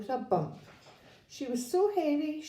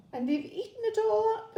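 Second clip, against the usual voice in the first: very high, tiny cub's complaint; tearful Baby Bear; 13 semitones higher than usual. A narrator's reading. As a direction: wail it like a little bear about to cry. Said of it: "and he began to cry".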